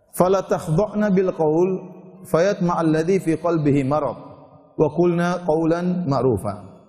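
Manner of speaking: slow, drawn-out chanted Arabic; Quranic recitation. Real, not acted. Preacher recites the verse to the women, slow chant. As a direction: intone the verse slowly and evenly.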